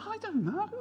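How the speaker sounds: high, soft bewildered protest; baffled. Retold or imitated. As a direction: squeak out helpless bafflement. Replays pastor stumped by problems.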